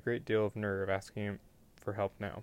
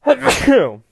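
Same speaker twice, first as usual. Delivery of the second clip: sudden loud, high sneeze; a real explosive sneeze; 20 dB louder than usual. Real, not acted. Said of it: "Bless me".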